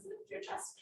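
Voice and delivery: high, soft whispery wizard voice; mock wizard whisper, played for a laugh; Gandalf voice. Yoga teacher repeating the cue in a mock wizard whisper. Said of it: "I don't know what accent that was".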